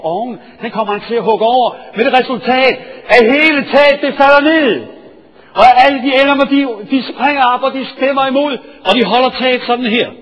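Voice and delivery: loud, high, driving sermon storytelling; full-force preaching. Acted. Preacher thrills his congregation with the roof story, loud and high.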